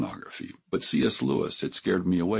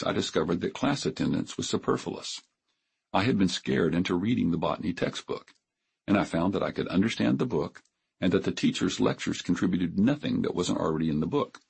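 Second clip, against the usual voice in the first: ordinary delivery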